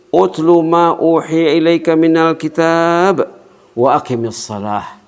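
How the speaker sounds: slow chanted Arabic verse; Quran recitation. Acted. Preacher chanting Quran to congregation, slow. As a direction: intone the verse slowly.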